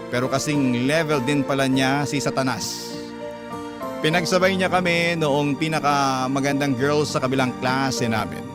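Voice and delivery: ordinary talk, nothing marked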